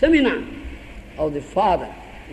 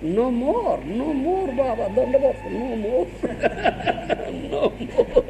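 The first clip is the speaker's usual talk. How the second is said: a light mock plea, trailing into laughter; mock lament; 3 semitones higher, 3 dB quieter, slower than usual. The monk acts out a regretful man begging the guru, lightly. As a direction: plead no more, half laughing. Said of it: "He should lament".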